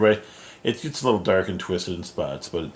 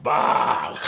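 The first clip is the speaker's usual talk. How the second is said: high, rough imitated burst; hammed-up evil outburst; mock villain roar; 7 semitones higher than usual. Evil Doom attacking Gwenpool, mock villain cry.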